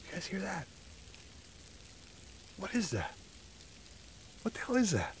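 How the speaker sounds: hushed. Real, not acted. Hears strange noise in woods.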